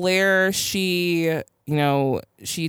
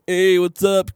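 Dan impression; 4 semitones higher, 5 dB louder, slower than usual. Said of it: "that's my Dan impression".

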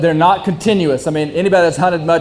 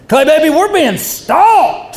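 much higher, slow, drawn-out preacher proclamation; Pentecostal preacher; 11 semitones higher, slower than usual. Retold or imitated. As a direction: preach the warning like a revival preacher. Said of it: "like a Pentecostal preacher".

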